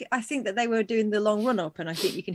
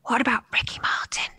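hushed whispered hint; whispering. Real, not acted. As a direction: whisper the suggestion.

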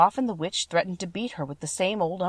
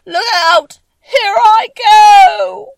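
very high, very loud drawn-out cry; final shriek; 18 dB louder, much slower than usual. Acted. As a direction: scream as she dissolves completely. Witch's final cry melting.

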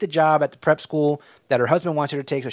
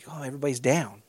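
much softer, dropped realization; mock realization; 4 semitones lower, 10 dB quieter, faster than usual. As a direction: let it dawn on you quietly. Coach noticing team's gloom.

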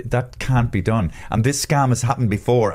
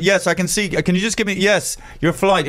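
higher, slowing, fake-helpful agent; string the caller along politely; mock scam agent; 7 semitones higher than usual. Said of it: "quoting the fake agent".

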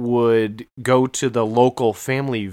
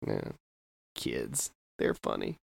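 much lower, much softer shrug of a line; low dry dad aside; 13 semitones lower, 14 dB quieter than usual. Retold or imitated. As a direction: mumble it like a resigned father. Dad's dry reply to sons.

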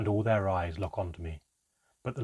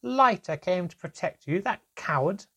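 high, loud mocking voice; roaring, laughing scorn; 10 semitones higher, 5 dB louder than usual. A narrator's reading. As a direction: scoff as if helpless with laughter. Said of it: "He roared again with laughter".

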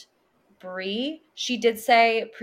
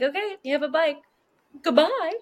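dismissive; 8 semitones higher than usual. Retold or imitated. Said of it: "she's like".

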